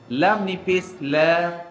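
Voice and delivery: ordinary talk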